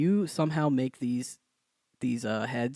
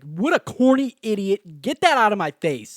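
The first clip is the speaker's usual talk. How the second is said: higher, louder mock scorn at a corny stranger; scornful sneer; 7 semitones higher, 9 dB louder than usual. Mocking his old reaction to a skater video, higher and louder scorn. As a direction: sneer it like the old grumpy you.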